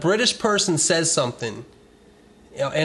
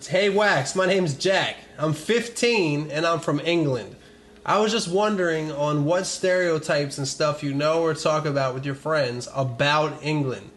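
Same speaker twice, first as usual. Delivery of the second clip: bad British accent; 3 semitones higher than usual. Reading a fan's letter in shaky British accent. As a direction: fumble through a British accent. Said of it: "I suck my accent so bad".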